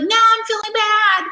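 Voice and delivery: high, loud exaggerated whine; mock wail. Client upset after ex interrupts her meditation, mock wailing. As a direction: wail it like a client whose mood is ruined.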